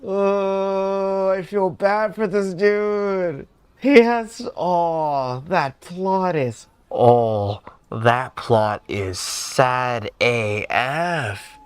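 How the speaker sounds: loud, high, drawn-out lament; pained sympathy. Real, not acted. Streamer reacting to a sad backstory, loud pained sympathy. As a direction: wail in sympathy for the character.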